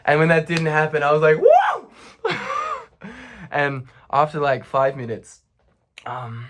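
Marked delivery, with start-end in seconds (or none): 1.4-3.1 very high, loud whoop; hammed-up cry of elation; mock celebration. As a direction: whoop it out in falsetto triumph. Retold relief and celebration.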